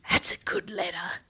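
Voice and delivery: soft awed whisper. Real, not acted. Admiring the letter, soft whisper. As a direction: murmur it with quiet admiration.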